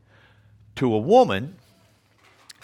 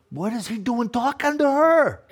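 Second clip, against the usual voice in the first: shocked disciples; 10 semitones higher than usual. Retold or imitated. Disciples' shocked question voiced.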